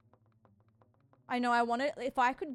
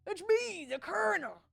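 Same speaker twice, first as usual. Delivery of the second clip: higher, louder hammed-up southern gentleman; mock Colonel voice, higher and louder with laughter; imitating the Colonel; 8 semitones higher, 7 dB louder than usual. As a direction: ham up a jolly old Colonel pitching a deal. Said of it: "I assume he sounds like this".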